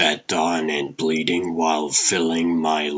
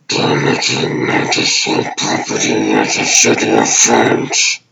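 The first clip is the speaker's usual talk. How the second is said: low, distorted machine announcement; robotic enforcer; 7 semitones lower, 5 dB louder than usual. Acted. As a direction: issue the warning like a robot cop. Automated enforcer's warning.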